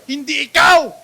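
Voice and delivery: loud, ringing declaration of not you; emphatic shout. Real, not acted. Preacher shouting to the congregation, loud emphatic. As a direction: call it out to the whole hall.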